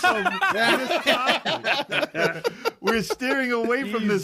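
high, slow words broken by laughter; laughing. Real, not acted. Host laughs off the topic, high and slow. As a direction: laugh while insisting you change topic.

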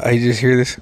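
ordinary talk

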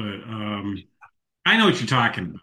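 ordinary talk

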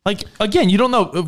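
ordinary talk

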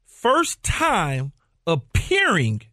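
drawn out word by word; measured, low and emphatic, pausing between words; slow, deliberate. Real, not acted. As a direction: lay it out very slowly. Spelling out his point step by step.

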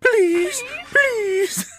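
very high, whiny pleading mockery; mocking high boy voice. [name] mocking Chuck's plea, very high and whiny. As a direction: mimic a crybaby begging. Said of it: "mocks him in a high-pitched boy voice".

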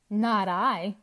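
loud, firm refusal; defiant cry. A narrator's reading. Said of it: "cried Pumpkin".

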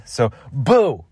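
high boo; startling boo. Acted. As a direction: shout boo to scare. Prankster interrupts.

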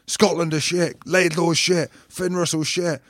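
lightly voiced gloating rival; mocking a cocky mate. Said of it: "Goody's like".

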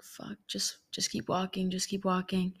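hushed inner voice, retold; soft, under-the-breath imitation of a private thought; muttered self-talk. Retold inner voice, avoiding him.

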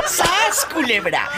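high laughing "¡Sas, culebra!"; catchphrase exclamation. Real, not acted. Host reacting to the joke, laughing catchphrase.

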